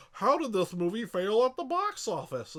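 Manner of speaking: high, loud, baffled mock question; baffled studio execs. Mocking the studio wondering why the sequel flopped, high and baffled. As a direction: play the clueless studio asking why it flopped. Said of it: "and then they're like".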